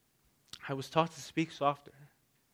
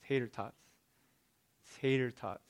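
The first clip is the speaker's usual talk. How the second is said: silently to himself; 7 dB quieter than usual. Retold or imitated. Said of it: "I was silently saying to myself".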